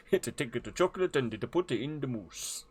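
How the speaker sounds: sing-song mock-Swedish accent; playful fake Swedish cook voice; Swedish Chef voice. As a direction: lilt it in a comic Swedish accent. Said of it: "the Swedish chef voice".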